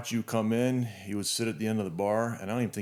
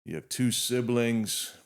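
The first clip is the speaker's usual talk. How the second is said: booming emcee introduction, slowing on the last line; formal introduction; 4 semitones higher, 6 dB louder, slower than usual. Acted. Host formally introducing his guest Roy, announcer style. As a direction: announce the guest's name high and loud, like a host's intro. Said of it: "let me give you this proper introduction".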